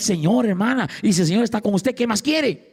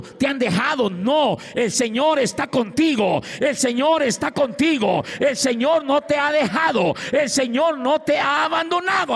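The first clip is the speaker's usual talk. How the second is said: rising, fervent preaching, higher and higher; impassioned sermon climbing to a cry; full-force preaching; 8 semitones higher than usual. Acted. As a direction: preach it to the back row, climbing in pitch.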